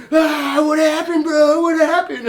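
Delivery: very high, loud mock shriek; imitated frantic, excited hollering; hysterical cousin. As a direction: yell it gleefully, nearly cracking up. Cousin rushes up filming, laughing.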